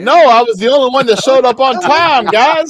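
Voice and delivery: high, loud indignant objection; loud protest. Real, not acted. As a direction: raise your voice and insist over the others. Protests teasing about forgotten opener.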